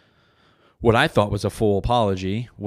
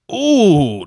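high, loud "ooh" of delight; a loud, drawn-out savoring ooh; relishing ooh; 9 semitones higher, 10 dB louder than usual. Pretend. Comic imagining his perfect combined experience, relishing ooh.

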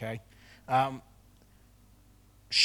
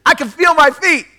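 much higher, louder joyful exclaiming; overjoyed woman; 13 semitones higher, 16 dB louder than usual. Retold or imitated. Pastor voicing the healed woman discovering her feet, ecstatic. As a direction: burst out with disbelieving joy.

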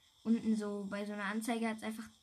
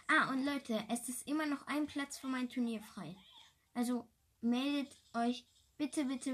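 normal talking voice

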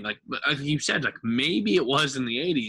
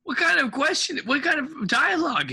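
incredulous; 6 dB louder than usual. Real, not acted. Reacting to absurd movie line.

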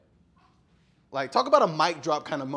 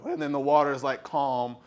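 usual speaking voice

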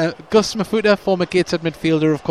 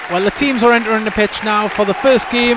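raised, louder commentator voice over the crowd; live match commentary; 6 semitones higher, 6 dB louder than usual. Real, not acted. Commentator to listeners as the teams come out, raised match-day announcing. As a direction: announce it high and loud to listeners.

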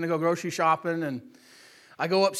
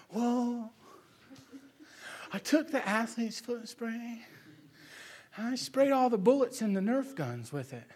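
tearful confession; 3 semitones higher, 9 dB quieter than usual. Retold or imitated.

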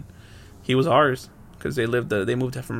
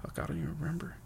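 muttering to self; 4 semitones higher, 15 dB quieter, faster than usual. Real, not acted. Frustrated at own forgetting.

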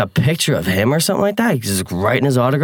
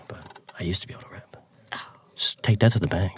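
whispered, half-joking boast; a confidential whisper of a drummer's boast; whispered aside; 3 semitones lower, 9 dB quieter than usual. Real, not acted. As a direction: whisper it like a secret. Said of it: "probably can't rip anymore".

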